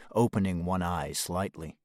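ordinary delivery

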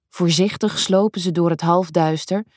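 ordinary delivery, nothing marked

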